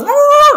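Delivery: high, loud drawn-out "wuuh"; sudden high whoop for a near miss; imitated swerving whoosh. (tiruan bunyi "wuuh" melengking)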